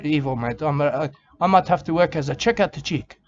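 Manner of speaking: ordinary talk, nothing marked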